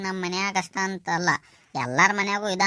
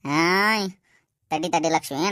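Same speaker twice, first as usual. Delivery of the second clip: ordinary talk, nothing marked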